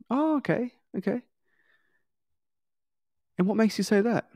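surprised salesperson. Shown as a demonstration. Salesperson responding to doubt.

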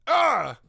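high, loud cry of frustration; short exasperated scream; frustrated yell. Acted. Frustrated cry after blaming others.

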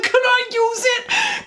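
high, slow begging voice; high, drawn-out plea to a friend; pleading little voice. Retold or imitated. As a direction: beg sweetly like a kid. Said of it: "I was like".

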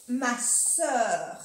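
"sœur" con la vocal francesa œ, labios redondeados; pronunciación francesa. Shown as a demonstration. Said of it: "les diré cómo se pronuncia". ("sœur" pronunciado a la francesa, con œ redondeada)

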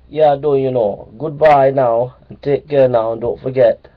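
a cheerful Jamaican uncle saying goodbye; Jamaican accent. Pretend. Uncle Joe bidding viewers goodbye, in a Jamaican accent. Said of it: "Uncle Joe says goodbye".

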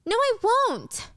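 high, drawn-out refusal; petulant protest. Acted. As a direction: whine back at your brother. Acted sibling quarrel.

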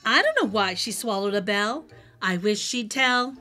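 ordinary delivery

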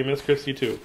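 ordinary delivery

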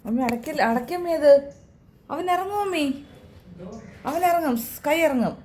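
high, urgent plea to mother; high urgent warning; urgent call. Real, not acted. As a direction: call out high so the door stays open.

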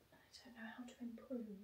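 unvoiced, very soft whisper. Real, not acted. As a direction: breathe it out hopelessly. Whispered despair after failing.

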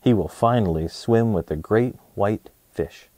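ordinary delivery, nothing marked

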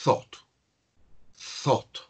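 "thought" as one syllable with open o and silent gh; pronunciation of thought. Shown as a demonstration. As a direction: model "thought" singly, like "thawt". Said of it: "se pronuncia".

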